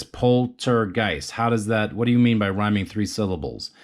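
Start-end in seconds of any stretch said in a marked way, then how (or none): 0.0-1.3 Poltergeist stretched slowly, every syllable marked; slow, deliberate Poltergeist. Shown as a demonstration. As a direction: break Poltergeist into its parts slowly. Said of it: "so let's look at this".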